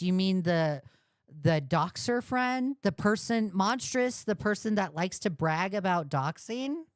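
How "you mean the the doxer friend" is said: ordinary talk, nothing marked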